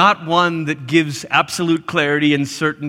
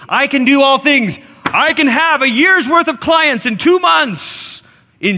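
higher, louder cocky boast; mock bravado; 9 semitones higher, 6 dB louder than usual. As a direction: brag like a prosperity preacher. Misreading of Paul mocked.

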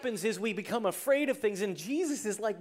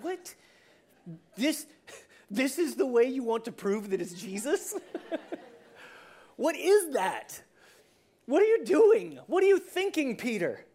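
stunned disbelief; 3 semitones higher than usual. Acted. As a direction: question him as if he's lost it. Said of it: "And they all go".

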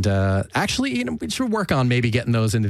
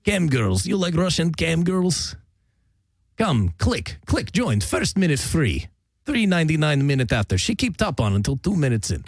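Bond villain voice; 4 semitones higher than usual. Retold or imitated. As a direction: read it like a hammy Bond villain. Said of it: "stereotypical, like, Bond villain voice".

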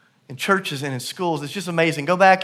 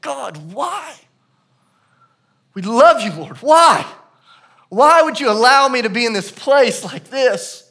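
high cry of despair, building louder; anguished weeping plea; 8 semitones higher, 6 dB louder, slower than usual. Retold or imitated. Father at empty cabinets asks God why, anguished plea. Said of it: "He's just weeping at the cabinets".

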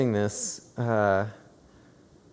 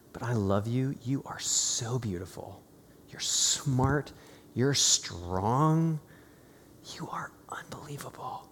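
tender reassurance; 7 dB quieter, slower than usual. Retold or imitated. Father tenderly reassures his hurting daughter.

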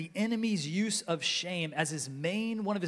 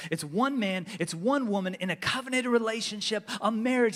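building louder and higher to a peak; rising preaching; 7 semitones higher, 5 dB louder than usual. Acted. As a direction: press the point harder. Preacher rises on marriage point.

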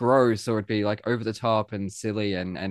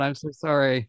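ordinary talk

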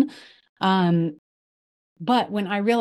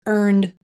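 EARNED drawn out and louder; stress on EARNED; 6 dB louder than usual. Real, not acted. Coach gives her formula for imposter syndrome, stress on EARNED. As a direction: lean slowly on the word "earned". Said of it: "emphasis on earned".

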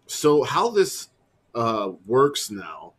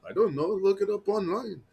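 broken English impression; 8 semitones higher than usual. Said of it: "doing an impression".

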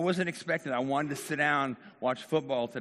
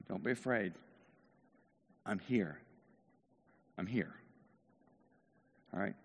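soft reassurance; 6 semitones lower, 9 dB quieter than usual. Acted. Jesus comforting terrified disciples.

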